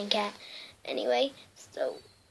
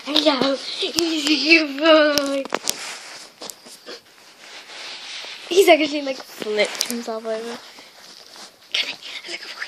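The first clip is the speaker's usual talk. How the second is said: excited dog talk; 7 dB louder than usual. Real, not acted.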